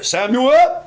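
high, loud, short call rising at the end; stranger's voice. Shown as a demonstration. Preacher showing a stranger calling Samuel, short rising shout. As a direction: call the name briskly and lift the ending. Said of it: "that's a stranger's voice".

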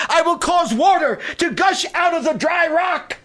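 high and loud, shouted promises with rising fervor; full-force preaching. Acted. Pastor voicing God's promises to the church, shouting them out.

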